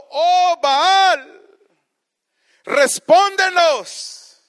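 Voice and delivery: a preacher voicing the priests of Baal calling out; crying out to Baal. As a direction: wail it up to the sky like a desperate priest.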